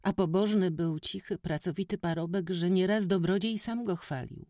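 put-on high, loud boyish chatter; eager village boy. A narrator's reading. Witek excitedly tells the stranger village gossip, high boy voice. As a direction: tell it all in a rush.

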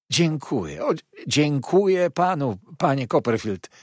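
high, loud gushing thanks; effusive exclamation. A narrator's reading. Heep thanking his guest for staying, effusively exclaimed. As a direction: cry out your fawning gratitude. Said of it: "zawołał".

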